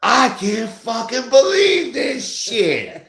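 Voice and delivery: high, slow drawn-out yell, half sung; screaming. Retold or imitated. Husband retelling yelling at the TV in a slow wailing scream. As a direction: stretch the rage into a wailing howl. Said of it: "I'm screaming".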